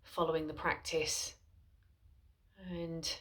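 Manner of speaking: soft, unhurried voice with long pauses; guided meditation. Acted. Mindfulness teacher guiding followers into a seated body scan, soft guided meditation. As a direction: settle listeners gently into stillness, softly and spaciously.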